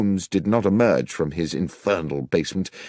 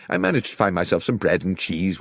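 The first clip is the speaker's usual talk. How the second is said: ordinary delivery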